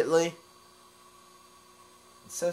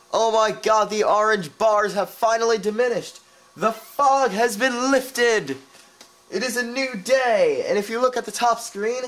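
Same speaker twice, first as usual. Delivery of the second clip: excited; 9 semitones higher, 11 dB louder than usual. Real, not acted. Fog lifts, triumphant game moment.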